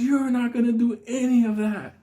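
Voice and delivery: high indignant warning; the Unabomber defiant. Retold or imitated. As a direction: lay down the law in a high strained voice. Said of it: "He goes".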